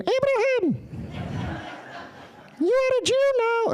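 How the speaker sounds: very high, squeaky divine voice; comically high-pitched almighty; God. Acted. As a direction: squeal the decree in a silly falsetto. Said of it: "We don't know what God sounded like".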